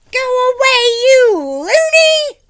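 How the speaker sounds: very high, loud shout. Acted. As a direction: shout him away, slow. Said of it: "the woman shouted".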